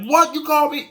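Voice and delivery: high, incredulous what; mock stunned indignation; indignant surprise. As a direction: react shocked and offended. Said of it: "They say it in a way you're like".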